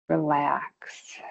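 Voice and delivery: soft, low, breathy whispered cue; whispered relax. Real, not acted. Instructor cueing the release of the feet, whispered. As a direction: let the word go out on a whisper.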